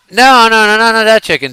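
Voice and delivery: loud, high call to people nearby. Real, not acted. Correcting the guys on which chicken, calling out loudly. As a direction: holler the correction across the room.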